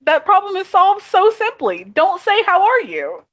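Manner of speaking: real frustrated outburst, pitched high; exasperated. Real, not acted. Exasperated complaint about greeting.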